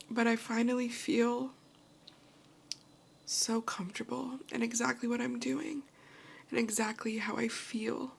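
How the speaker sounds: emotional, close to tears; happy crying. Real, not acted. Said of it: "These are happy tears".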